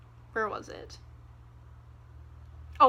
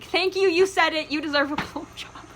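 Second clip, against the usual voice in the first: mock cheer; 4 semitones higher than usual. Mock cheering Zed's line.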